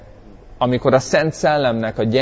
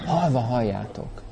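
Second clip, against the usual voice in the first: "Halva halljátok" said soft and quiet; quiet caring version of the line; gentle, loving; 7 dB quieter than usual. Shown as a demonstration. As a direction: speak "Halva halljátok" tenderly, no boom. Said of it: "Szeretet, törődés".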